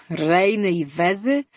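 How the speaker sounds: slow, scoffing question with a loud snort of contempt; scornful snort. A narrator's reading. Berro scoffs at the king's talk of small animals, with a contemptuous snort. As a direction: scoff at the idea as if it were absurd. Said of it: "prychnął Berro".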